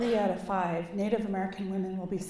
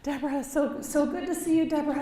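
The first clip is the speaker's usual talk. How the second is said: a light, higher, lightly voiced cheerful greeting; Senator Murray's greeting; 6 semitones higher than usual. Senator Murray welcoming Deborah at the door, lightly voiced, a bit higher. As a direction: greet an old friend brightly, a hint of the senator. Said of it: "walking right to the door saying".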